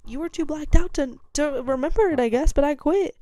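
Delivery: high, loud mocking comeback; snarky comeback. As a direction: needle the boss, smug. Retold comeback to drunk boss.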